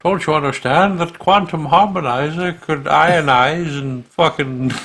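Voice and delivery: mock-hysterical scientist ranting; panicked rant. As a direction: spiral into a breathless panic.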